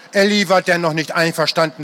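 usual speaking voice

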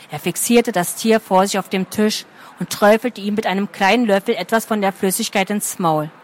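plain reading voice

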